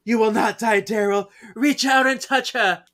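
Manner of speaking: high, loud urging; urgent spirit chorus. Retold or imitated. Spirits urging Daryl to touch Prue, high excited push. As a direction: push him forward, rising and loud.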